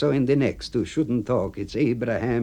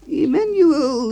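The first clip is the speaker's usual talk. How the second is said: put-on much higher, louder gruff old friend; 7 dB louder, slower than usual. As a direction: play the cemetery clerk high and loud. AB voiced.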